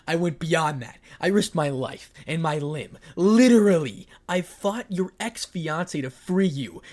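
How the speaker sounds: loud, bitter shout; an angry lover yells how much he risked. Acted. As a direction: hurl it at her. Issei shouts his grievances.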